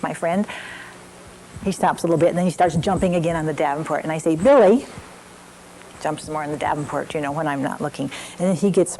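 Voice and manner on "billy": put-on louder, higher call of the child's name; warning call. Mother calls to son jumping again, warning him.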